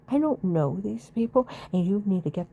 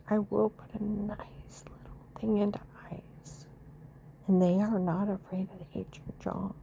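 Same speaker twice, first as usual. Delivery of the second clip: whisper; 8 dB quieter than usual. Real, not acted. Whispered aside about the family.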